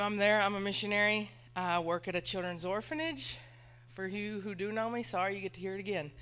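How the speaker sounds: ordinary talk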